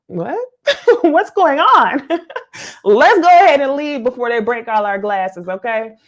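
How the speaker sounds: mock shocked worker peeking out; imitating confused staff. Imagined whiskey staff reaction.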